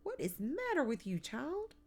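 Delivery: high, loud, quick exasperated question. A narrator's reading. Aunt scolds half-dressed child, exasperated. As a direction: throw it out fed up, pitch shooting up. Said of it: "in an exasperated voice".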